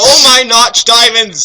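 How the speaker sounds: high, loud, slow shout of joy; shouted Steve. Acted. Reader voicing Steve shouting over his gifts, high and loud. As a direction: belt it out in delight. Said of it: "said, shouted Steve".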